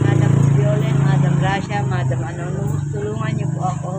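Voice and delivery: loud begging call, names stretched out; loud pleading. Real, not acted. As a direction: call out the names, asking for help. Beggar pleads to online donors. (malakas na pagsusumamo)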